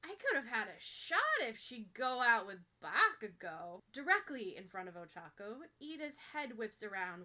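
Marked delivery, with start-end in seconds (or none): 0.0-3.8 loud, casual musing for all to hear; mock-wistful loud aside. A narrator's reading. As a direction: think aloud far too loudly. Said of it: "muses extremely out loud".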